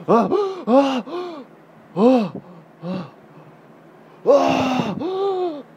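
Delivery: high loud excited ohs. Real, not acted. Excited play with toddler.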